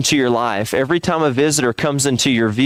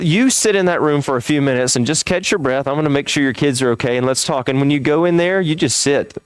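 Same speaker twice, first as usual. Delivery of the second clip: ordinary talk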